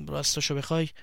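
unsure, quietly rising بخوای; hesitant embarrassed start. Acted. Shy boy hesitating to confess.

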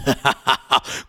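short eerie chuckle; a creepy little cackle; monster laugh. Acted. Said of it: "مثل یه اژدها".

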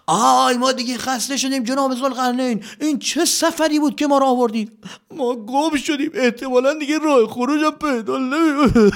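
high whiny soldier voices; high complaining troops. Acted. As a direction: moan about being lost.

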